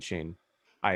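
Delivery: normal talking voice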